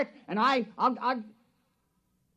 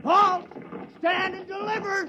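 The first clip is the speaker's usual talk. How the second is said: commanding shout; 11 semitones higher than usual. Acted. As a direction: bark the order to stop them in their tracks. Tom ambushes the inspector.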